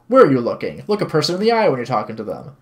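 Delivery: loud opening rebuke; scolding. Acted. Woman scolds kneeling visitor over eye contact, scolding. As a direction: tell them off firmly.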